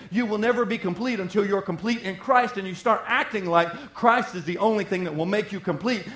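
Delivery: ordinary delivery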